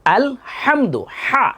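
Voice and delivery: "আলহামদু", then "হা", with a thick breathy h; correct throat h. Shown as a demonstration. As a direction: model Arabic "হা" in "আলহামদু". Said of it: "একটু খেয়াল করবেন".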